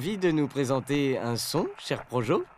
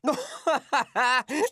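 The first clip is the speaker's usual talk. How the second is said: real laugh; 8 semitones higher than usual. Real, not acted.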